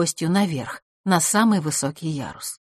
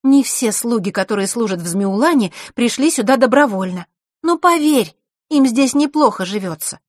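high, louder insistence; insistent girl; 6 semitones higher, 5 dB louder than usual. Acted. Scene: Zakhara defending her family's servants, raised and firm. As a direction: press the point firmly.

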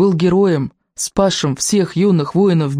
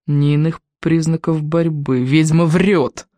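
ordinary delivery

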